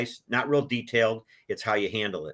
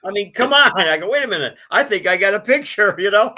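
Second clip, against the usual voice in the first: loud, high, laughing through it; 9 semitones higher, 9 dB louder than usual. Real, not acted. Telling the interviewer about a funny photo, laughing loudly. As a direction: burst out laughing mid-sentence.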